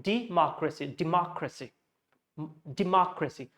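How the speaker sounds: democracy as "di-MOCK-ruh-see", repeated as a model; democracy with second syllable distinct, the rest reduced; stress on MOCK. Shown as a demonstration. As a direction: put the weight of democracy on MOCK. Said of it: "the stressed portion is mark".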